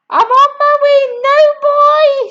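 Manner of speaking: high, loud, fast call; Grandma calling down to George. Acted. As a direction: holler down from above. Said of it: "she called down".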